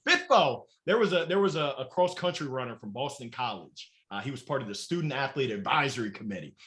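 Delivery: ordinary delivery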